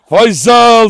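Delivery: loud, high call across the yard; calling out the dog's name. Real, not acted. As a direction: shout the name to call him over. Calling the dog excitedly. (köpeği yüksek sesle çağırma)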